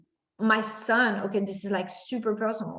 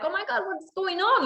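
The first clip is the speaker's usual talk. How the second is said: freaking out; 10 semitones higher than usual. Retold or imitated. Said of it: "freaking out to the doctors".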